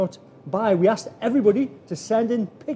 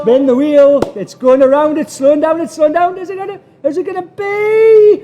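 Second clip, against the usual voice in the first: rising, louder excited commentary; hyped giveaway announcement; 7 semitones higher, 9 dB louder than usual. Real, not acted. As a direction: call the wheel spin with mounting excitement. Live wheel spin commentary.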